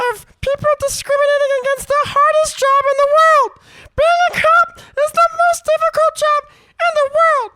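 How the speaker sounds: high, squeaky squirrel rant. Acted. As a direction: rant like an indignant cartoon squirrel. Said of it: "the essence of the squirrel comes out".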